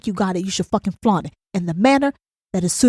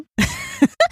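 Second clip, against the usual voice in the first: short, high-pitched cackle; amused high giggle; high laugh. Acted. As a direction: let out a quick high laugh at the nasty comment. Mock reaction to nasty comments.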